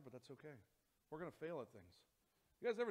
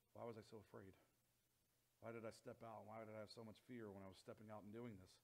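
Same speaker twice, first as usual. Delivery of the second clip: soft wondering; 4 semitones lower, 7 dB quieter than usual. Retold or imitated. Relief after landing softly.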